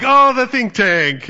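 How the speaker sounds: high, loud, slow and drawn out, mimicking the shop owner; bookstore lady's greeting. Bookstore owner spotting her yearly customer, high drawn-out greeting. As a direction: stretch it out high like a delighted shopkeeper. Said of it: "she's like".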